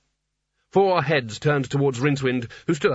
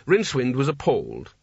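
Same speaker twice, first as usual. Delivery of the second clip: usual speaking voice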